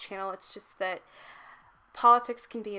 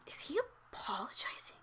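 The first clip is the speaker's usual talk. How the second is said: whispered, then a much higher disbelieving squeak; stunned whisper; 9 semitones higher, 5 dB quieter than usual. Retold or imitated. Passenger whispering in disbelief at the driver, hushed then high. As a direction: hush it, baffled, then climb high on sorry. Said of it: "and I was just like".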